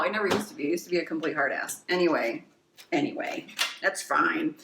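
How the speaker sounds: ordinary talk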